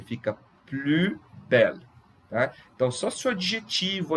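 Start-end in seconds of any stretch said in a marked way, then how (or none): 0.7-1.9 "plus" said as "plu" before belle; silent S. Shown as a demonstration. Teacher contrasting with liaison case, "plus belle" with silent S. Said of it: "Não pronuncia o S".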